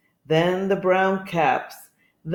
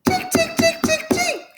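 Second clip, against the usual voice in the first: very high squeaky monkey ticks; tiny staccato squeaks, mimicking monkeys; monkey chatter. A narrator's reading. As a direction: chirp it like a cheeky little monkey. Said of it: "monkeys ... back at him and said".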